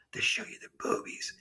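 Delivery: hushed, confidential whisper; whisper in the ear. Retold or imitated. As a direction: leak a naughty secret under your breath. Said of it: "reached in his ear and I go".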